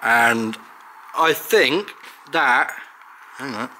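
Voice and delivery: slow, muffled with a pen in the teeth; pen in mouth. Real, not acted. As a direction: speak with a pen clamped in the teeth. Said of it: "let's get that pen out of my mouth".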